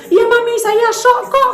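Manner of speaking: put-on a child admitting she's shaken, high-pitched; shocked daughter.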